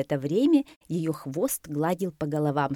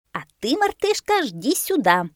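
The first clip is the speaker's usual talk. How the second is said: very high, loud bossy parrot; commanding parrot; 9 semitones higher, 5 dB louder than usual. A narrator's reading. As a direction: order the monkey into place. Попугай командует мартышкой. (командующий попугай, очень высоко и громко)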